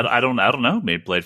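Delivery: ordinary delivery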